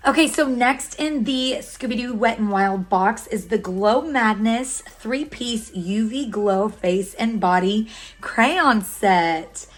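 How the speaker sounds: usual speaking voice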